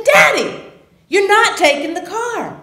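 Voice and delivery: high, loud telling-off; exasperated scolding. Retold or imitated. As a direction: lay down the law to your father. Retold scolding of father at door.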